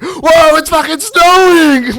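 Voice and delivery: high, loud, stretched-out yell of surprise; excited shout. Retold or imitated. Friend retelling the driver filming the snow, shouting. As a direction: yell it like you just saw snow. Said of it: "be like".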